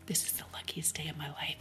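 hushed, whispered retelling; barely voiced, as if from a stretcher; imitated weak whisper. Injured woman to the EMT on the gurney, imitated whisper.

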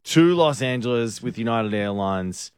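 brisk host sponsor plug; upbeat radio promo read. Acted. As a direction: sell the airline like a live ad. Reads sponsor line live.

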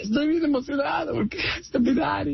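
high-pitched, hammed-up weeping with emotion; mock crying. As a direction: pretend to blubber, voice way up high. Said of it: "Estoy llorando". (llanto fingido, muy agudo)

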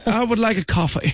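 high, prim British accent, imitating mom; mock British accent. Mother ordering coffee at Disney, mock British accent. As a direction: put on a posh-sounding British accent for the order. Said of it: "elle avait un accent british".

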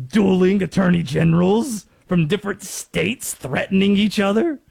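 a hint of Stefan's breathless hype, building; Stefan impression. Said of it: "to quote Stefan from S N L".

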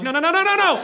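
high, loud rapid-fire no's; mock panic, rising and loud; Tetris-losing panic. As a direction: yelp it as the pieces pile up. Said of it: "when you lose in Tetris".

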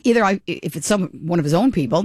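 ordinary talk

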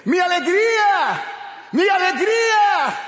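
high, loud astonished shout; shocked cry. Retold or imitated. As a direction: cry out the name in disbelief. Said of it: "la gente se espanta y dice".

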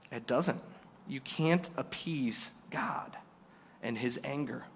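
slow, hushed solemn whisper. Real, not acted. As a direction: drop to a grave whisper.

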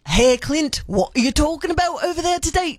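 amateur Irish accent, hurried and lifted. Shown as a demonstration. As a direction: try a chatty Irish accent. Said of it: "Irish accent".

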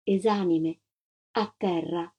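usual speaking voice

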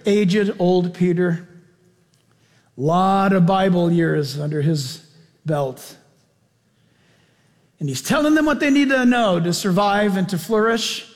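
usual speaking voice

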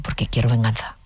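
low, lightly acted ghost; retold spirit caller. (imitando a un espíritu vengativo, en tono grave)

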